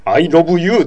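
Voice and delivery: imitated Korean rendering of "I love you", o for the vowel; Korean-style "lobu". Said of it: "愛のラブをロブということだよね".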